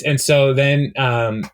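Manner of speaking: ordinary talk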